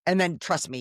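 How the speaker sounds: usual speaking voice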